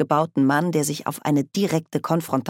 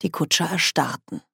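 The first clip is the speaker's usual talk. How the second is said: ordinary delivery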